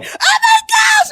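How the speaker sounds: high crying squeal, slow; mock weepy fangirl shriek; shrieking like a teary seminary girl; gushing seminary girl. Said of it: "these little seminary girls going".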